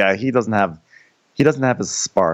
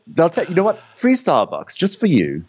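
mock sales pitch; 8 semitones higher than usual.

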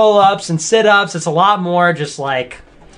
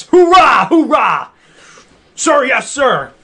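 shouted military call and response; drill sergeant; 6 semitones higher, 3 dB louder, slower than usual. Acted. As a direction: bark it like a soldier at boot camp. Mock military drill chant.

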